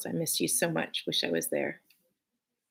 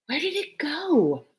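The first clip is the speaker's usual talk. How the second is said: put-on high, curious child asking; a small girl wondering aloud; little girl voice; 11 semitones higher than usual. Usha asking grandfather, high and curious.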